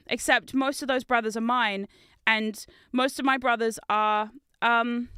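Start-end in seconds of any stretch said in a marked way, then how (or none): none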